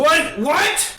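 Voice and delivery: startled. Acted. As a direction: caught off guard, startled. Guards startled by intruders.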